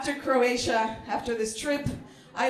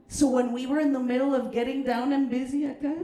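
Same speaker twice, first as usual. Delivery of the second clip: ordinary talk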